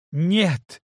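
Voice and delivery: sharp cry of no; shout. Acted. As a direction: snap out a loud no. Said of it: "Кейт сорвалась на крик".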